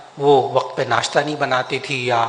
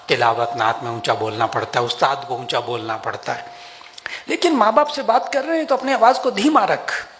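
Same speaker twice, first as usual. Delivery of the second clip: normal talking voice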